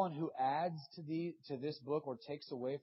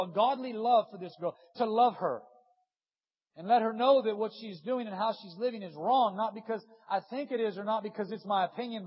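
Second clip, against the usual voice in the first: higher, loud, pleading; impassioned prayer; 8 semitones higher, 11 dB louder than usual. Acted. Preacher reliving his prayer for a classmate, higher and loud. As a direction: pour it out to God.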